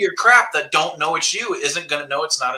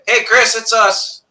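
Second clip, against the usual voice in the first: higher and louder, slow greeting shout; cheery call; 9 semitones higher, 6 dB louder, slower than usual. Retold or imitated. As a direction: sing it out to the recorder. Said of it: "The minute they saw my red bucket".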